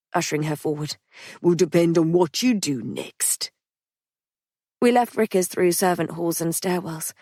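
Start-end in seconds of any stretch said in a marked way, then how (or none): 1.4-3.5 measured menace; Patrick warning Polly. Acted.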